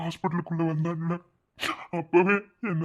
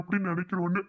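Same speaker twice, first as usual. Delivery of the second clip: normal talking voice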